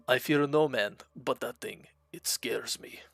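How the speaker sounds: put-on Russian accent, fading to a whisper at the end; lightly done Russian accent, ending in a whisper; better Russian accent. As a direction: redo the Heavy's line in a Russian accent, dropping to a hush. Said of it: "That was a little better".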